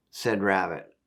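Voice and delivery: ordinary delivery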